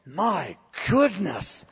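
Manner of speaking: slow, hushed stunned voice; dumbfounded murmur; awed onlookers. Retold or imitated. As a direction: step back, taken aback. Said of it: "they're going".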